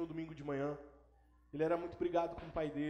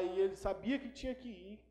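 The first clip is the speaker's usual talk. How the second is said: ordinary talk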